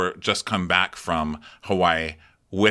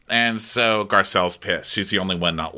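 ordinary delivery

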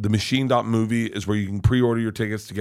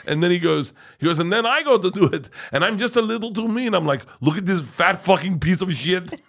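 horrible Arnold Schwarzenegger impression; 4 semitones higher than usual. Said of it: "I'm doing a horrible Arnold Schwarzenegger impression".

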